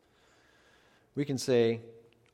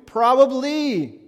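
slow, drawn-out, much higher knowing "probably"; 12 semitones higher, 10 dB louder, much slower than usual. Acted. Preacher teasing his congregation about their past, a slow, high, knowing drawl. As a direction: stretch it out with a wry, teasing lift.

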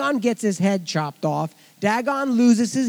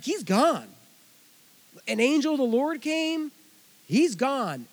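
alarmed soldiers; 6 semitones higher than usual. Retold or imitated. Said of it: "and they say, like".

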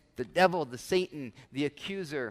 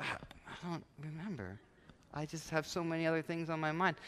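vague forgetfulness; 11 dB quieter than usual. Acted. Churchgoer asked about the sermon, quietly vague. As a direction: trail off as if your mind is elsewhere.

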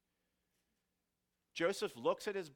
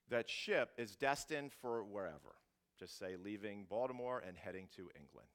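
ordinary talk, nothing marked

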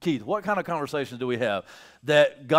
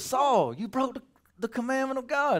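higher, lightly voiced Samuel accusing; voicing Samuel; 8 semitones higher than usual. Preacher as Samuel accusing Saul, lightly voiced.

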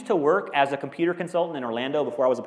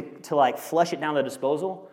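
normal talking voice

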